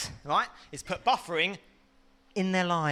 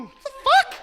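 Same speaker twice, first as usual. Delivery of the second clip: high loud sudden shock; 8 dB louder than usual. Retold or imitated. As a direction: blurt it like sight just returned. Towel touches face, sight restored.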